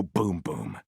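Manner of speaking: very low, booming echo of the word; deep boom. Pretend. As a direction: drop the voice right down and boom it. Echoing a title for effect.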